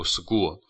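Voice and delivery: "school" pronounced like "sgool"; k voiced as g. Shown as a demonstration. Teacher summing up the voicing rule, "school" said with g for k. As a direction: turn the k of "school" into a hard g. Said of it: "就是要读school".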